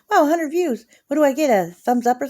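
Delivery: high, loud mock wow; mock excitement. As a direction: fake delight at a hundred views.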